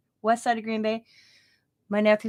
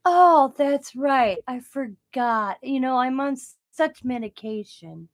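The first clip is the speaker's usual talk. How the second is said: very slow, drawn-out mock dismissive; mock airy excuse; 3 semitones higher, 3 dB louder, slower than usual. [name] brushing off the officer on the phone, slow mock airy. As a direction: stretch it out like a careless shrug.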